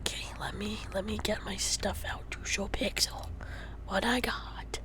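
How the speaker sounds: soft hushed whisper, breath only. Real, not acted. Showing Pixel the stuff, whispering. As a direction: whisper so nobody wakes. Whispering while setting up items.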